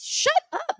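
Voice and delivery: leaping way up in pitch with surprise; a high squeal of "no way"; shocked disbelief. Real, not acted. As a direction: shriek it in delighted disbelief. Shocked at winner's name.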